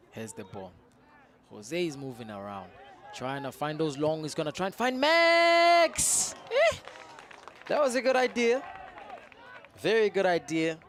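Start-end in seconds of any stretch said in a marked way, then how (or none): 4.2-6.8 high, loud, drawn-out excited shout; excited call. Real, not acted. Commentator calling the long pass to Max, high excited shout. 7.7-10.8 excited praise. Real, not acted. Praising the attempt right after.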